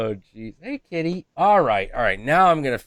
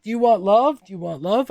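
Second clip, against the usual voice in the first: put-on higher, louder baby-talk coo; cooing to the cat; 9 semitones higher, 4 dB louder than usual. Asking the cat for cuddles, higher cooing voice. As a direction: sweet-talk a pet. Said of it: "every time I'm like".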